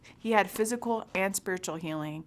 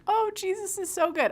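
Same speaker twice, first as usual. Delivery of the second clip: much higher, gushing teary donor; mock gushing; 14 semitones higher than usual. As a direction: parody the moved crowd. Mimicking moved donors.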